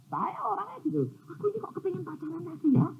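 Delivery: high, fast playful voice of a man in love; imitating a lovestruck man, high and fast; mock smitten man. Man confessing wish to date.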